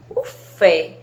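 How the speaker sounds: loud, isolated "fé" in "O fé"; stressed syllable. Shown as a demonstration. Teacher singling out the strong syllable, "fé" said forcefully. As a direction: punch out "fé" on its own. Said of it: "A sílaba que vocês falam com mais intensidade".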